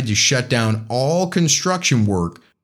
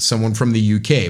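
usual speaking voice

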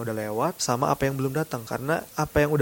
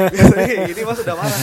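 loud, higher voice through laughter; laughing retold quote; 5 semitones higher, 8 dB louder than usual. Retelling what girlfriend or staff say to him, laughing loudly. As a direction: mimic the remark, cracking up.

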